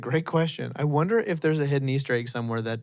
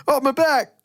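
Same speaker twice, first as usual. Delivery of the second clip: high-pitched mock cry of alarm; 10 semitones higher, 3 dB louder than usual. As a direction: play a sudden exaggerated yelp. Retold cartoon character falls apart.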